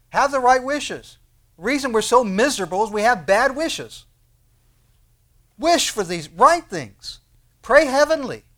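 high, loud exhortation in weighty phrases; forceful preaching. Real, not acted. Preacher urging the congregation to wish rightly, forceful preaching. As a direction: drive each command home to the congregation.

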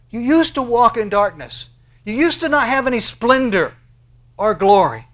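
high, loud proclaiming; fervent pulpit shout; preaching shout. Acted. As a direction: lift it up and call it out.

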